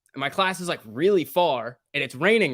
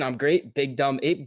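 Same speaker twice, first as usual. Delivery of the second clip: ordinary talk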